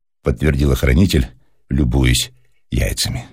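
plain reading voice

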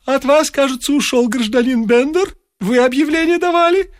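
high, sing-song widow lament; lilting lament pitched high. A narrator's reading. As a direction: croon the words like a grieving widow. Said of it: "запела вдова".

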